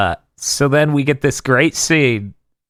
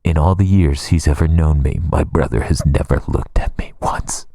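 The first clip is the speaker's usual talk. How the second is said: retold solemn confession; 9 semitones lower than usual. Retold Rukia's grave confession to Renji.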